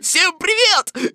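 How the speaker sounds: loud, high laughing greeting; boisterous, merry tiger voice; cheerful tiger. A narrator's reading. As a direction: burst in laughing, then draw out the hello. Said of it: "весело сказал тигр".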